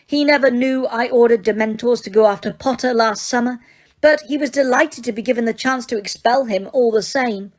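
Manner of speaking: high, firm enraged witch; clipped fury held in check; Umbridge voice. Acted.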